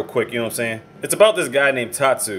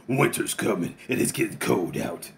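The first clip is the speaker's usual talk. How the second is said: low, slow, soft yakuza voice; Tatsu's deep voice; 5 semitones lower, 7 dB quieter than usual. Retold or imitated. Ex-boss to his old henchman, low and slow. Tatsu putting gloves on gunman.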